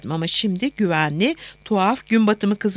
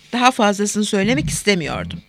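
usual speaking voice

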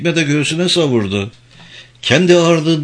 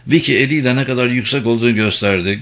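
ordinary delivery

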